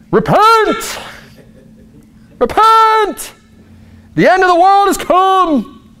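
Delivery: high, hammed-up yelling doomsayer; mock street preacher. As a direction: bellow it like a street-corner prophet. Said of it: "Does it sound like Jesus was a street preacher? Yelling at people?".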